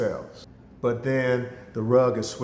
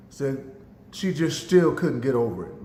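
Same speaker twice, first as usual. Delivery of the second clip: normal talking voice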